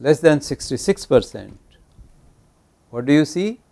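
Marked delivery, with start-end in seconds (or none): none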